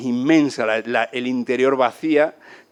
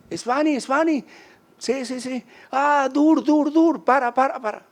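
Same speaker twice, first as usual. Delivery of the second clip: put-on high, excited kid calling out; boy's high voice; 12 semitones higher, slower than usual. Little boy calling to the travelers. Boy running after the riders.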